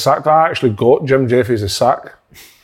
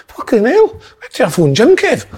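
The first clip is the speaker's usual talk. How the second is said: higher imitated shocked exclamation; shocked reaction; 8 semitones higher than usual. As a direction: retell a pal's stunned outburst. Retold shocked reaction on phone.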